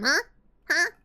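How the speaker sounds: ordinary talk, nothing marked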